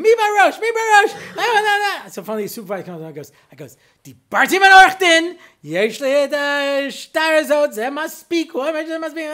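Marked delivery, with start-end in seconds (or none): 0.0-2.1 high, very loud hysterical demand; frantic comic tirade at full volume; screaming. Retold or imitated. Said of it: "So I started screaming". 4.0-9.2 high, loud ranting tirade; frantic shouted protest; screaming in broken Hebrew. Retold or imitated. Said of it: "the whole thing like this in my broken Hebrew".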